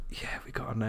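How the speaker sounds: quiet, half-hearted regret; deflated repeat. Retold or imitated. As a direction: mutter it with the excitement drained away. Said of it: "Six months down the line".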